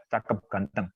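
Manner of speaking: normal talking voice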